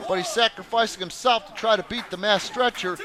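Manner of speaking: usual speaking voice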